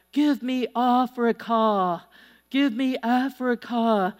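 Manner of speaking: slow, mock German-accented cry. German evangelist pleading with God for Africa, slow German-accented cry. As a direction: imitate a German preacher's drawn-out plea. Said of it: "But in his voice, it sounded like".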